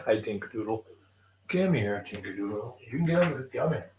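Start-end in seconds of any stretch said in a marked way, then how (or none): none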